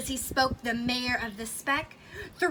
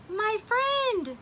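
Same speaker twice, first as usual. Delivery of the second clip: a lightly raised, higher small-person voice; a hint of a tiny grateful mayor; tiny mayor's voice; 8 semitones higher than usual. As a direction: voice the little mayor, a touch higher. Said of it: "came the voice".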